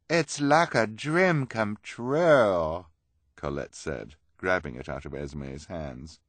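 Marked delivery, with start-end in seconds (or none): 0.1-2.9 high, loud, drawn-out rapture; dreamy-happy character exclamation; thrilled. Acted. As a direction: gush it slowly and loudly. Colette thrilled by the gift.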